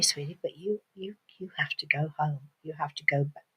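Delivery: soft, low, tender insistence; hushed, apologetic words to a child; gentle lady. Acted. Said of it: "very gently took the little girl".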